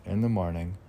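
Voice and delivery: normal talking voice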